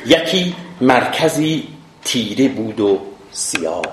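slow epic declamation with long pauses; measured classical Persian poetry reading; verse recitation. Acted. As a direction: recite each half-line deliberately, falling at its end.

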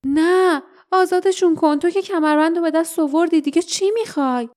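high-pitched, fast pleading cry; desperate shout. A narrator's reading. Lief begging Dain to free the prisoners, high urgent shout. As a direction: cry out in protest, pitch way up, rushing. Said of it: "لیف فریاد زد".

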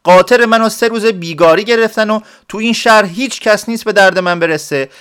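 high, loud desperate complaint from a peasant; villager's angry outcry. Acted. Peasant cries out his woes to the clerks, loud and high. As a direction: rant it out, wronged and fed up. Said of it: "داد یارو در اومد".

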